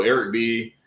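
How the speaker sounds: ordinary talk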